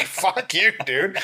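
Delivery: high, laughing fuck you; laughing mock insult. Retelling meeting Ben, laughing mock insult. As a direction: spit it out through laughter.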